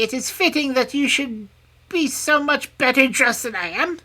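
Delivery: high, loud, bargaining crone; scheming old hag. A narrator's reading. Hag bargaining for the princess's clothes, slyly.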